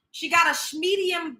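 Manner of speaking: ordinary delivery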